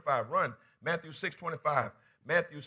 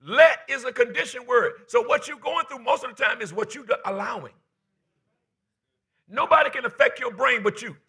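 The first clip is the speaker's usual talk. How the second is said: loud, pounding preacher voice; full-force preaching; 10 semitones higher, 11 dB louder than usual. Acted. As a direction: drive it home to the church.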